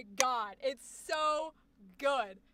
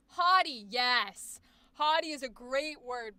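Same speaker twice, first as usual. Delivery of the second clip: usual speaking voice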